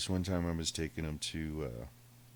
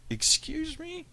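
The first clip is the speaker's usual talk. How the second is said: much higher squeaky indignant question; outraged disbelief; 4 dB louder, slower than usual. Retold or imitated. Owner told to open the door, squeaky indignant disbelief.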